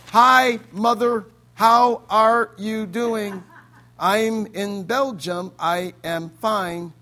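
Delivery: very slow, deliberate words; careful, measured. Retold or imitated. Son on phone to his mother, slow and guarded. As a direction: pick every word carefully so nothing slips.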